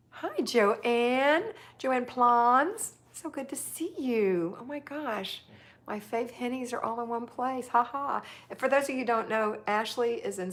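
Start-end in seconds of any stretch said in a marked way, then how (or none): 0.1-5.4 higher-pitched, excited hello; gushing, sing-out welcome; delighted greeting. Real, not acted. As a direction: greet a dear friend with real delight. Greets a friend joining live.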